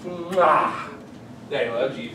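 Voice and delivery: soft, tender daddy voice. Real, not acted. Talking to his baby daughter.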